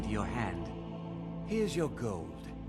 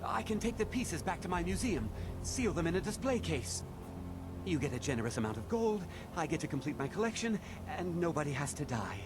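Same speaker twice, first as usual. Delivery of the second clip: high, panicked begging; pleading; 8 semitones higher than usual. Acted. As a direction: talk fast to save your life. Silas begs for his life.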